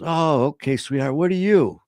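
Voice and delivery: put-on high, cooing talk to a child; man greeting a girl. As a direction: dote on a little girl at the door. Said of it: "he sees this little girl".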